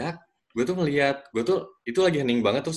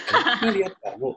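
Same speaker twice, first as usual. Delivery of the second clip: higher stern question with a laugh through it; mock stern blunder; 10 semitones higher than usual. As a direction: replay the flubbed line half laughing. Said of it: "Tapi gue malah".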